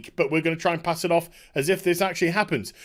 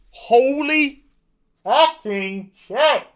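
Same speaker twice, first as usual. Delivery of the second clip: a slow, drawn-out, higher exclamation of shock; stunned disbelief; 5 semitones higher, 4 dB louder, much slower than usual. Real, not acted. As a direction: drag it out like you can't believe your eyes. Reacting in disbelief.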